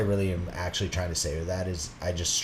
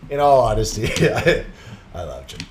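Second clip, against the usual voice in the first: ordinary talk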